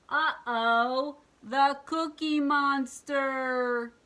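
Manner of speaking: high, loud, slow mock alarm; hammed-up uh-oh, drawn out; alarmed witch. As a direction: cry out in mock panic as the witch. Witch sees trouble coming.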